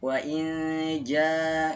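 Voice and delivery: "wa in ja" with "in" blurred into a long hum; slow recitation, hold the hidden n of "wa in ja", long "ja"; veiled n. Shown as a demonstration. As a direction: blend "in" into "ja" with a drawn-out nasal. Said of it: "dibacanya eng dulu nih".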